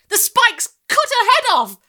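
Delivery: high, loud, slow gleeful punchline; dramatic finish. A narrator's reading. Boy finishing dramatically, high and loud. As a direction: land the gory line with glee. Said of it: "Jack finished dramatically".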